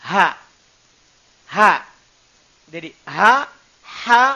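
Ha breathed from deep in the throat; lowest throat ha. Shown as a demonstration. Teacher showing students the lowest throat letter, deep breathy demo. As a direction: sound Ha from the lowest point of the throat. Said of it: "Tenggorokan paling bawah".

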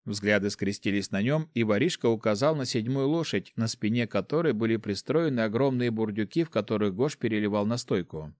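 ordinary delivery, nothing marked

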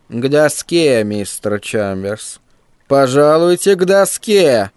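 a hint of a creaky, nasty schoolteacher; lightly voiced mean old teacher, slow and creaky. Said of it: "противно скрипучим голосом".

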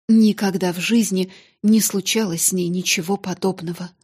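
ordinary delivery

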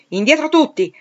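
loud, high shout to halt everyone; shouted order. A narrator's reading. Rochester shouting at well-wishers to get back. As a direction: bark it out to stop the crowd. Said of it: "gridò il signor Rochester".

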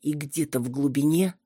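ordinary delivery

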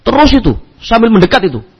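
usual speaking voice

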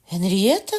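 surprised cry. A narrator's reading. Margaret cries out recognising her friend. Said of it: "скрикнула Маргарита".

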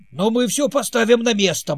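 loud, high angry bark. Acted. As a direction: snap it out like a grumpy king. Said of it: "рявкнул король".

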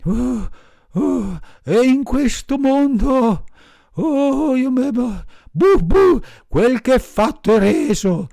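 high, loud dog voice, thanks broken by barks; high loud barking thanks; grateful barking dog. A narrator's reading. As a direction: stammer thanks between barks. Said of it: "rispose il cane".

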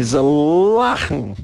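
"lachen" stretched out very slowly; lingering, exaggerated stress on "lachen"; drawn-out emphasis. Real, not acted. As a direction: hold the opening sound of "lachen" long.

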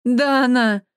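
loud high call of her name; crying out a name. A narrator's reading. Husband calling his dead wife's name, loud. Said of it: "воскликнул мужчина".

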